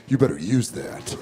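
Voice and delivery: low, quiet action-movie one-liner; cool action hero. Retold or imitated. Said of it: "they always say some cool shit like".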